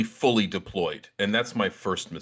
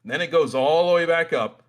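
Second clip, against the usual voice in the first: ordinary delivery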